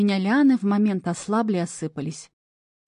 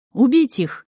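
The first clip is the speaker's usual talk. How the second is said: loud commanding kill order; menacing order; 4 semitones higher, 5 dB louder, slower than usual. A narrator's reading. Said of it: "грозный приказ".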